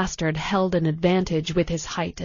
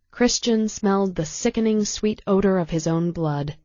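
usual speaking voice

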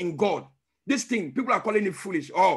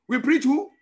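rousing call; 5 semitones higher, 5 dB louder than usual. Real, not acted. Call to congregation.

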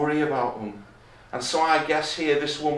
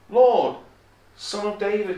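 raised call, loud on "Lord"; higher, earnest appeal; crying out; 5 semitones higher, 5 dB louder than usual. Acted. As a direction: call out high, easing off after the first word. Re-voicing the woman's cry to Jesus.

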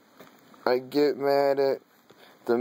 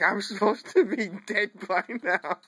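laughing quote; 6 semitones higher than usual. Retold or imitated. Laughs quoting grandmother.

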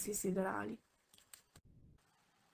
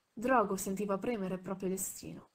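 plain reading voice

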